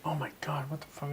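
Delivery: hushed, barely voiced mock exasperation; retold annoyance. Retelling getting mad while driving around, hushed mock annoyance. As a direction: mimic being mad, very softly. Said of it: "I'd get mad in school and be like".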